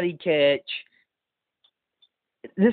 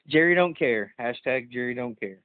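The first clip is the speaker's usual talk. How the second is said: usual speaking voice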